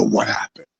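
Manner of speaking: low, soft, lost "What happened?"; dazed mumble. Retold or imitated. Wrestler mumbles to his wife, low and bewildered. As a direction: drop low and quiet, bewildered.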